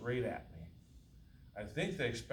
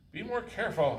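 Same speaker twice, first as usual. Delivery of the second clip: put-on high, loud crackly old woman yell; crackly old lady voice; 10 semitones higher, 9 dB louder than usual. Disguised man yells back as old lady. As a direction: holler it like a cranky old lady. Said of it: "in my best crackly old lady voice".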